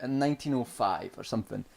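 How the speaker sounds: ordinary talk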